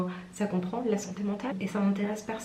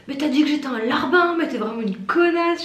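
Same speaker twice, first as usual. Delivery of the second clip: higher, louder retold outburst; mock angry yelling; 6 semitones higher, 5 dB louder than usual. Enraged warehouse worker confronting her over an insult, imitated yelling. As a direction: imitate an outraged accusation. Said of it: "Il vient me voir en hurlant".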